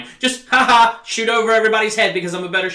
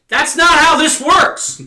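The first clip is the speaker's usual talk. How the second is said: exasperated shout; 8 semitones higher, 7 dB louder, slower than usual. Real, not acted. Ranting about the show's logic.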